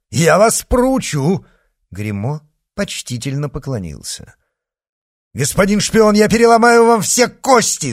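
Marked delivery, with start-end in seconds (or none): 0.1-1.5 loud, high, drawn-out threat; indignant raised voice; exclaimed threat. A narrator's reading. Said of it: "воскликнул герцог". 5.4-7.9 loud, high-pitched furious threat; enraged shout. A narrator's reading. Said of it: "закричал разгневанный герцог".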